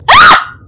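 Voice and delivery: sudden high, loud shriek; startled scream. Real, not acted. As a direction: scream in real fright. Flung out of boat.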